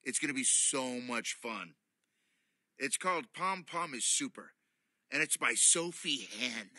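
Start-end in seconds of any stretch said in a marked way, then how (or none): none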